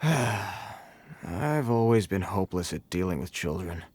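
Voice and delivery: sigh, then admit defeat; weary, self-deprecating resignation; resigned sigh. Acted. Gives up dealing with child.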